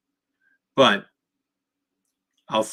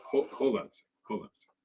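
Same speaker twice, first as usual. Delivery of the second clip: retold hushed calming; 3 semitones higher, 6 dB quieter, faster than usual. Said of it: "I was like".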